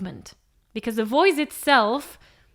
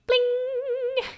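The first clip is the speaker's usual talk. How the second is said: high, ringing, held "bling"; rubber band twang; 14 semitones higher than usual. Retold or imitated. Teacher mimics a plucked rubber band, high sustained twang. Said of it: "taking your finger and doing".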